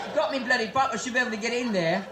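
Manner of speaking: put-on colloquial English voice; English accent. Englishman with a pipe talking his way in, English accent. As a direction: argue your way in like a comic Englishman. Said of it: "I'm from England".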